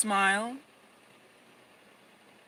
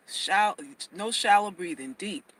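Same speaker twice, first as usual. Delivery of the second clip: ordinary talk, nothing marked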